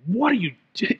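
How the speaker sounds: mock parental exasperation; frustrated parent. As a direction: start a scolding question, then break off. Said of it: "like that frustrated parent".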